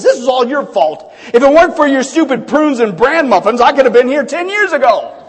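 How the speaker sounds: acted angry old-man outburst; fit of anger. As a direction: blame your wife in comic fury. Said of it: "he goes into a fit of anger".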